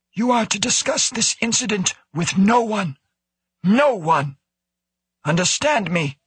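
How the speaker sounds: high, sharp director's voice; sharp warning. A narrator's reading. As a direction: pitch it way up and warn them sharply. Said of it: "Wo said sharply".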